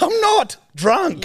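high, slow slurred denial; drunk denial. Retold or imitated. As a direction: insist you're sober, badly. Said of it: "I'm like".

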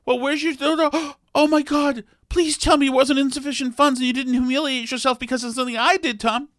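high, gasping imitation of a horrified wife; mock-dramatic high wife voice, gasping oh my God; panicked wife. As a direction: play the wife finding out, aghast and pleading. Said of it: "the tragedy could unfold that way".

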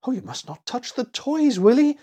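put-on high, alarmed little girl; acted fright, rising to a loud high name; frightened gasp. A narrator's reading. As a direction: warn in fright, voice pitched high. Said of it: "gasped poor Annie in fright".

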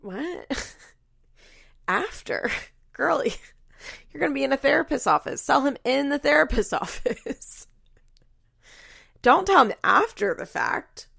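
high-pitched disbelief breaking into laughter; incredulous. Retold or imitated. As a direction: laugh in disbelief as you scold. Acted reaction of disbelief.

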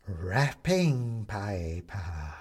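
greedy creepy skeleton; savouring skeleton. A narrator's reading. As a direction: roll the words around with relish.

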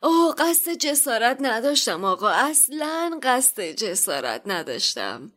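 grovel before an offended customer; apologetic shopkeeper. Acted.

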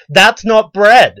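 high, loud, slow indignant protest; outraged Karen. Retold or imitated. Customer outraged at the sliced loaf, loud and slow. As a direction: wail it like the loaf insults her.

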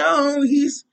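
mocking offended fans. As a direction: mimic touchy fans whining. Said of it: "try to say".

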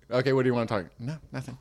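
normal talking voice

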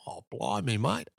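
low, soft, rough stab at Australian; attempted Australian accent. Imitating the director spotting pennies, attempted Australian accent. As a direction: mumble it in a shaky Aussie accent. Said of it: "I don't know what I'm doing in an Australian accent".

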